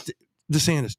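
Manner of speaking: ordinary delivery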